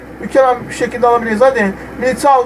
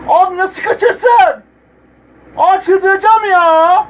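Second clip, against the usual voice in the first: drawn-out despair; 8 semitones higher, slower than usual. Real, not acted. Gamer despairs over missed goal.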